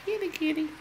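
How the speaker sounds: high sing-song call to the cat; kitty call. Real, not acted. Calling the kitten from across the room, high and sweet. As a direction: coax the cat over in a high voice.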